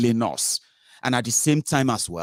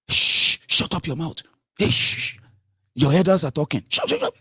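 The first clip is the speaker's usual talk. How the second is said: sharp shushing, then high scolding; loud, high-pitched hushing reprimand; scolding elder; 6 semitones higher, 4 dB louder than usual. Acted. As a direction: shush them like an offended elder. Acting elders silencing the young.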